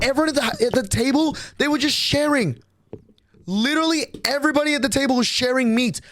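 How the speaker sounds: outraged rant. Real, not acted. Outraged at being scammed.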